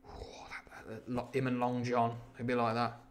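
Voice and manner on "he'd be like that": ordinary delivery, nothing marked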